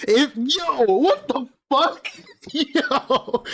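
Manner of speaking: high, incredulous what-the-fuck; can't believe what he just heard; stunned disbelief. Real, not acted. Reacting in disbelief to clip.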